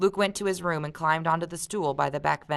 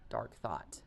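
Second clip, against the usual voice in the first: hushed; 7 semitones lower, 17 dB quieter than usual. Real, not acted. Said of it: "dark remark".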